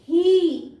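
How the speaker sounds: ही loud, with a held "ee"; long E demo. Shown as a demonstration. Teacher repeating examples for pupils, ही with long E. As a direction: stretch ही out loudly. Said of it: "ये long ई".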